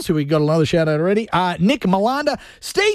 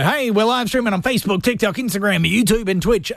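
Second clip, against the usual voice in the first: ordinary delivery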